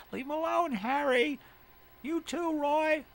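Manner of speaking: very high, loud character screech; scolding yell. Acted. Telling Harry and Roy to back off, screeched scolding. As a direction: shout them down in a high voice.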